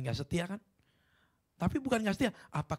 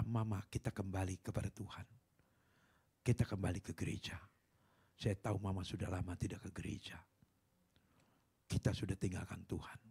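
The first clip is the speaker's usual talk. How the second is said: soft pleading; 7 semitones lower, 7 dB quieter than usual. Retold or imitated. Daughter pleads softly with mother.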